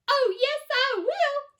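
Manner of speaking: put-on high, loud squeak of a tiny boy; squeaky tiny voice. A narrator's reading. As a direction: squeak it defiantly. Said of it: "squeaked the tiny little voice".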